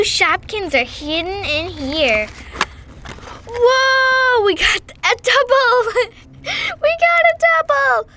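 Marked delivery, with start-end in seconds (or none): 3.5-8.1 high, loud excited squeal; thrilled surprise at a double. Real, not acted.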